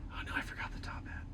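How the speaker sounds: fast whispered dismay; whispered realization. Acted. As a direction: whisper it quickly as it dawns on you. Realizing forgotten top hat.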